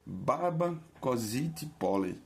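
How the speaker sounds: baba, kozit, pole said as Russian with stress on each first syllable; Russian words spoken carefully; Russian example phrase. Shown as a demonstration. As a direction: read it out in Russian, each word stressed at the start.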